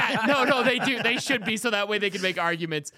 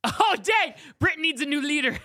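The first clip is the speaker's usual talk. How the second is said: excited gasp; 7 semitones higher, 4 dB louder than usual. Acted. Joking surprise at the news.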